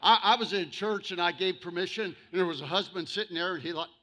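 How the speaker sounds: loud, rising sermon voice, near shouting; full-force preaching. Acted. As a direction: preach it to the back row.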